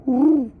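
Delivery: a high little dog woo, jumping well up in pitch; imitated dog woo. Telling about Sasha, imitating her little dog woo. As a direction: copy the dog's soft woo call. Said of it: "This is one of her little soft woos".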